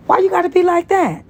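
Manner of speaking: high, fast whine; whiny plea. Acted. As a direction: whine like a spurned lover. Tony pleading, voiced high.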